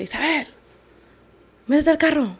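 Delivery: high, restrained mock yell; retold yell. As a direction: imitate a yell from afar without raising volume. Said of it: "I just hear yelling".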